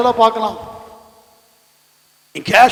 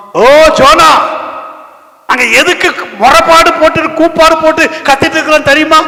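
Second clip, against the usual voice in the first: high, loud outcry; shouted reproach; 9 semitones higher, 6 dB louder than usual. Acted. Ship's crew confronting sleeping Jonah, shouted reproach.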